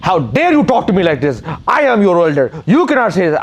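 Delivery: high-pitched imitation of an outraged elder; uncle losing it. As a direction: mimic an indignant older man blowing up. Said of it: "freaking loses it".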